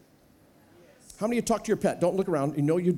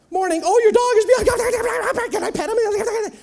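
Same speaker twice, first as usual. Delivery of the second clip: put-on high, loud, slow baby-talk delight; doting pet voice; gushing over dog; 13 semitones higher, 7 dB louder, slower than usual. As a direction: coo over the dog, much higher and louder.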